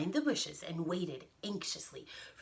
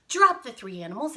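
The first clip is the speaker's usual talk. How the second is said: ordinary delivery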